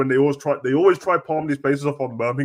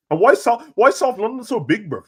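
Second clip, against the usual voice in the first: mock outrage; 9 semitones higher, 4 dB louder than usual. Real, not acted. Outraged at South London's size.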